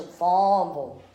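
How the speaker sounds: imitated child's Southern drawl on Farmville. Mother imitating her daughter, Southern drawl. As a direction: drop the r for a Southern "Fahmvul". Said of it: "Gentry has that drawl".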